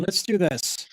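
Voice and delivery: ordinary delivery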